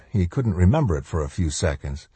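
usual speaking voice

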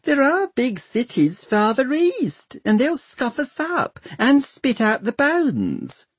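very high, alarmed servant; high-pitched anxious gossip; fretting servant. A narrator's reading. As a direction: warn of doom in a high voice.

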